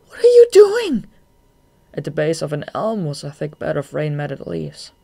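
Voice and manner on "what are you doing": very high, loud cry of alarm. A narrator's reading. Shade sees Marina land on the ground, alarmed. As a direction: shoot way up in pitch and volume. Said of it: "To his alarm".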